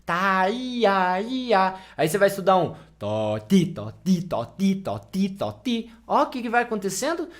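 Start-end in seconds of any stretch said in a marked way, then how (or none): 0.0-1.8 drawn-out "Tá, i, a" with wide-open A; broad Brazilian "Tá, i, a", vowels far apart; big tongue movement. Shown as a demonstration. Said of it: "Ó o tamanho do movimento". 3.0-5.9 separate, contrasted "tó, ti"; distant syllables. Shown as a demonstration. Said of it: "Você vai deixando isso tão distante".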